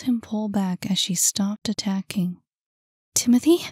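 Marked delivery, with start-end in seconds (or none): 3.1-3.6 higher, louder rising question; surprised question. Acted. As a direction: ask it in startled disbelief. Girl recognises him, unsure.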